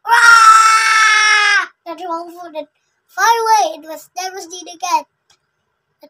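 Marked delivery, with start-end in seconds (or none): 0.1-1.7 a big loud roar as he flies off; troll's loud cry. Acted. Troll knocked off the bridge, loud roar. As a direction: let out a loud defeated roar.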